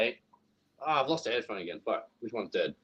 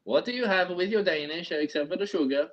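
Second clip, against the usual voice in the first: higher-pitched mock Danish accent; 4 semitones higher than usual. Asking a friend about pastries, mock Danish accent. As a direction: ask like a sing-song comedy Dane. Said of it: "You did the Danish".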